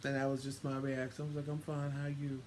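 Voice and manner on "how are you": usual speaking voice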